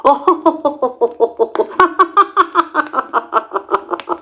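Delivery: high mocking laugh; engines laughing. A narrator's reading. Two engines laughing at the trucks, high mocking laugh. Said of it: "the two engines laughed".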